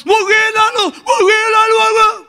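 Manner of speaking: high, loud mocking yelp; shrill comic alarm; mock panic shout. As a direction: ham up someone freaking out.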